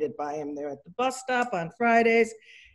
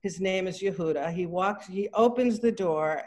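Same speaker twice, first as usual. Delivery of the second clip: usual speaking voice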